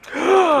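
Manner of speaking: sharp, high, loud gasp; sudden excited intake of breath. Real, not acted. Reacting to a big hit in game.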